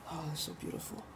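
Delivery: a soft, hushed, moved sigh of words; barely holding it together; emotional. Real, not acted. Moved by his own point, hushed and tearful. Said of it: "I'm a little emotional".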